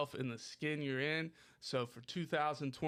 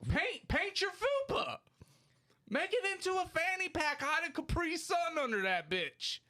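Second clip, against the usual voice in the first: high, strained shout, much higher pitch; exasperated yelling; 11 semitones higher than usual. Real, not acted. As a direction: yell it out as an urgent pitch. Exasperated, yells out his advice.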